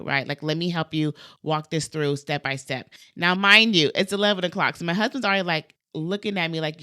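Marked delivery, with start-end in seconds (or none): none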